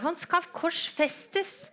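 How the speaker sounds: high, slow, drawn-out scream; screaming crowd. Acted. The crowd screaming back at Pilate, high and drawn out. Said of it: "Men de skriker bare høyere".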